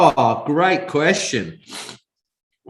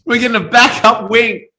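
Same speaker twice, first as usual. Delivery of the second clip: higher, louder, cheering; excited; 10 semitones higher, 7 dB louder than usual. Real, not acted. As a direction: announce it like a small victory. Cheering the spare wing.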